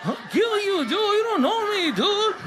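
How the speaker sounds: high, hammed-up threats; mock tough. Punked kid threatening an absent parent, high mock-tough voice. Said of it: "trying to make yourself feel more tough".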